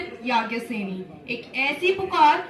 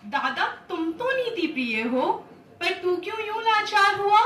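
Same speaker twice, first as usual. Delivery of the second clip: reproachful questioning; 7 semitones higher, 3 dB louder than usual. Acted. Draupadi asks her grandsire why he is helpless, reproachful. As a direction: pitch rising into a pained challenge.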